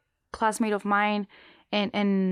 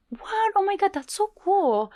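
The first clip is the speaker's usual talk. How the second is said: high excited exclamation; thrilled recruiter voice; amazed squeal; 11 semitones higher than usual. Retold or imitated. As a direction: squeal with delight. Recruiters amazed at theater director.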